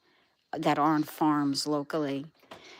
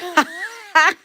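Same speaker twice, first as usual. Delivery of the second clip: laugh; 11 semitones higher, 6 dB louder than usual. Real, not acted. Laughing at own joke.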